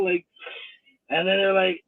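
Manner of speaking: ordinary talk, nothing marked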